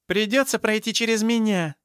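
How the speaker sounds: high, forceful defiant cry; growled defiance. A narrator's reading. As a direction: stand your ground with a heated, high voice. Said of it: "зарычала Зинаида". (грозно, с вызовом, на высоких тонах)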